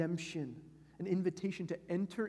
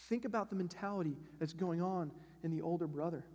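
ordinary delivery

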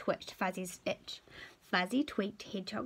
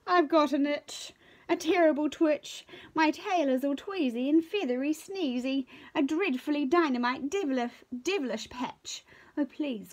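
higher, louder fretful possum voice; itchy possum; 5 semitones higher, 4 dB louder than usual. Acted. Possum begs for a scratch of his tail, fretful. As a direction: complain in a higher pleading whine. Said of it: "cried Possum Pie".

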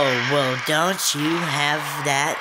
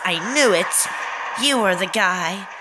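higher, put-on girl voice; 4 semitones higher than usual. Shown as a demonstration. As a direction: show off your mock girl voice. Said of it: "I did my girl voice".

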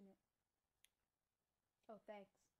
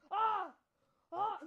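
frightened scream; 7 semitones higher, 19 dB louder than usual. Real, not acted. Jump scare in horror game.

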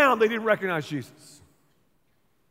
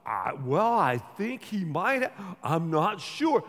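slow, hesitant and halting; unsure disciples; 2 semitones lower, slower than usual. Retold or imitated. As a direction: stall as if afraid to say it. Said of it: "the disciples are like".

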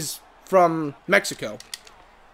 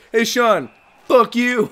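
raised, louder mock taunt; cheeky mock insult, higher pitched; impression of Kyle; 6 semitones higher, 5 dB louder, slower than usual. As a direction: jab at Sean in a buddy's voice. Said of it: "That's not how Kyle sounds".

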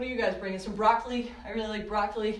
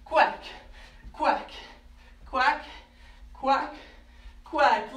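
duck quacks; 3 semitones higher, much slower than usual. Pretend. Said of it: "Let me hear your quacks".

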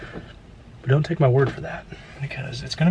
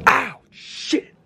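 sudden pain; 12 semitones higher than usual. Real, not acted. Hurts himself while sanding.

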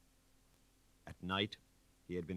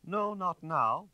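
higher, louder, slow and teasing; slow mocking drawl; 12 semitones higher, 6 dB louder, slower than usual. A narrator's reading. Inspector teases Denègre, slow and mocking. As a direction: savour the denial slowly. Inspector toying with his victim.

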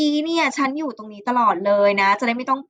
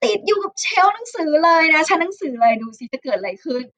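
ordinary delivery

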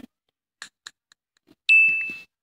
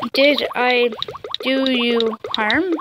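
ordinary talk

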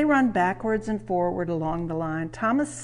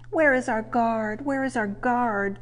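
higher, mildly distressed cry; 4 semitones higher than usual. A narrator's reading. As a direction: call out anxiously for the guard. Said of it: "they cried".

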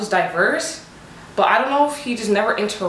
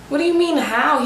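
pitched up in disbelief; incredulous retort; 7 semitones higher than usual. Retold or imitated. Retorting to her boss in high, incredulous disbelief. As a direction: throw his question back in disbelief.

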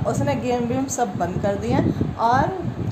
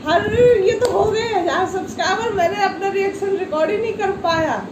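ऊँची, ज़ोरदार चौंकी खुशी; सपने में चौंक कर खुश होना; उत्साहित सोच; 8 semitones higher than usual. Retold or imitated. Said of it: "और मैं सोच रही". (ऊँची आवाज़ में उत्साहित चौंकना)

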